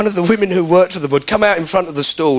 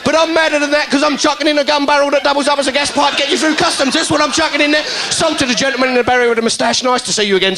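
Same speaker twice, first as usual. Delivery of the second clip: put-on high, fast barrow-boy sales pitch; market trader patter; 9 semitones higher than usual. Trader hustling a crowd in a high, rapid pitch. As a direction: flog it like a dodgy market trader.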